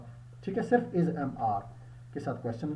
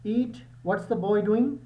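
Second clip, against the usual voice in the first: teacher's question; 6 semitones higher, 5 dB louder, much slower than usual. Shown as a demonstration. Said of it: "teacher asks class".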